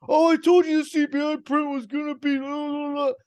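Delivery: high, sing-song mockery of a know-it-all; mocking whiny voice. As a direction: sneer it up high like a smug tweeter. Mocking Twitter know-it-alls.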